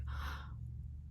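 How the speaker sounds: sharp gasp of disbelief; shocked gasp. Real, not acted. Staring at the positive test, a shocked gasp. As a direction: suck in air in shock.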